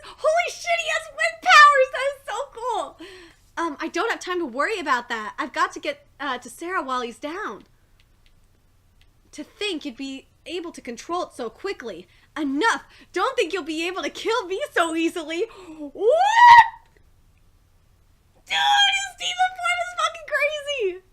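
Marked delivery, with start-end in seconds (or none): 0.0-3.0 much higher and very loud, thrilled; amazed shriek. Real, not acted. Reader amazed by wind powers. 15.8-21.0 piercing high shout breaking into a laugh; shocked scream. Real, not acted. Reader sees demon form.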